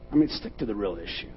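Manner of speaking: normal talking voice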